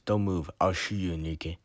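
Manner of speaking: plain reading voice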